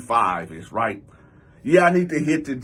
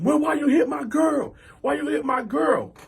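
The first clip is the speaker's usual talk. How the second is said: high-pitched indignant demand; mock outrage; 9 semitones higher than usual. Cousin confronts him over his girlfriend, high indignant protest. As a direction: act out an upset cousin, voice pitched up.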